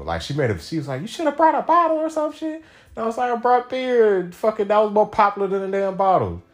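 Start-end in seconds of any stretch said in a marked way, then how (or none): 1.0-2.6 high, louder mock girlfriend voice; hammed-up nagging girlfriend. Girlfriend mocked in a quarrel. 3.3-6.4 high, louder indignant retort; mock outraged snap back; heated comeback. Retold heated comeback in a quarrel.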